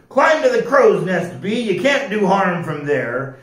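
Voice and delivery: lightly roared pirate command; mild roar. A narrator's reading. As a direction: roar it out lightly, easing off at the end. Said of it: "Jack roared".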